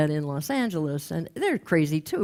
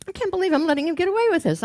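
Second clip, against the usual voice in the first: much higher, fast retold outburst; indignant realization; 12 semitones higher, 3 dB louder, faster than usual. Woman realizing it after her outburst, indignant. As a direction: blurt it out high and quick, amazed at yourself. Woman newly in touch with feelings.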